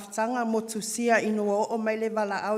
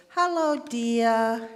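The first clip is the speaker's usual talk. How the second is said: higher, louder and slow, her fond greeting; imitating Auntie Tofi; 4 semitones higher, 8 dB louder, much slower than usual. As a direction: draw the greeting out like the aunt. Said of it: "That's how she always talked".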